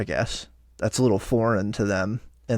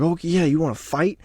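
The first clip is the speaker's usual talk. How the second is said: higher, mocking pitcher voice taunting a fight; 6 semitones higher than usual. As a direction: tease him like a pitcher itching for a fight. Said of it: "And then Framber's like".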